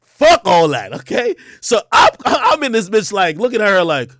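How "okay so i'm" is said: ordinary delivery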